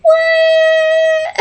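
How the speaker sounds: very high, drawn-out disbelief; exaggerated high squeal of astonishment; mock shocked squeal. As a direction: imitate a stunned man in falsetto. Retold shocked reaction to her age.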